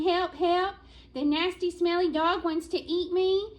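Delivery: high, loud cry for help; panicked shout. Acted. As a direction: squirrel yelling for its life. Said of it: "a squirrel was shouting".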